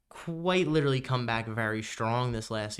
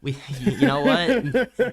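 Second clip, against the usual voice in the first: laughing; 2 semitones higher, 7 dB louder, much slower than usual. Real, not acted. Laughs at political joke.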